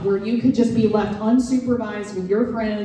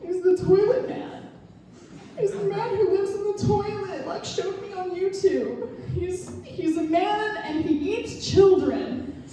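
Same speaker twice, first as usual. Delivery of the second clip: put-on much higher, tearful kid voice; little boy voice; 11 semitones higher than usual. Son telling mom he fears Skibidi, high scared child voice. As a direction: play a scared five-year-old, pitched way up. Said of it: "And he's like".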